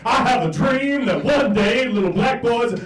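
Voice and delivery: put-on high, booming oratory from a child; loud, hammed-up orator impression; Martin Luther King Jr. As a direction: belt it out like a theatrical speech. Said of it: "doing a full M L K impression".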